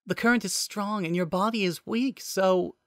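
high-pitched AI assistant explaining; Nicole the AI, apologetic. Acted.

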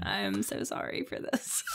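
whispered through laughter. Pretend. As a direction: whisper the apology, half laughing. Mock apology.

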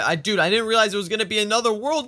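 usual speaking voice

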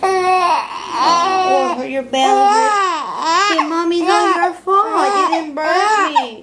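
high, slow cooing over her belly. Real, not acted. Mother comforting baby with tummy pain, high coo.

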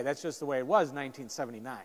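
normal talking voice

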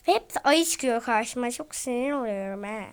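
ordinary talk, nothing marked

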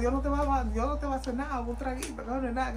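high, insistent coaxing; pushy drinking buddies. Retold or imitated. The boys urging the pastor to drink, high insistent coax.

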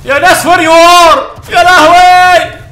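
loud, high shouted appeal; calling out. Real, not acted. Player searching for teammates, loud high call. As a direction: call out for the missing team.

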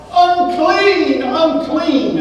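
high, loud shouted cry; shouted warning. Retold or imitated. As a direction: shout it out to warn people away. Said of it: "he had to shout".

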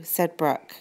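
plain reading voice

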